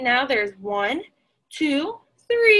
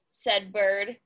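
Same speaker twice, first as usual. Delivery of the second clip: plain reading voice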